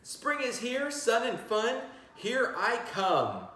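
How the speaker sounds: high, gleeful cheer; excited rabbit. A narrator's reading. Teacher reading the rabbit waking for spring, high and gleeful. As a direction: burst out of bed with joy. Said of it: "the rabbit's in bed saying".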